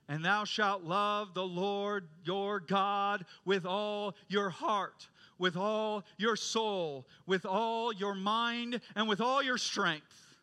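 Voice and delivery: slow, measured proclamation of a commandment; solemn scripture recitation. Acted.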